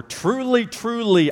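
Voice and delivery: Truly, truly jumps high on the first word, then steps down; emphatic repetition. Shown as a demonstration. Preacher voicing Jesus for emphasis, high first Truly. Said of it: "as a way of emphasizing".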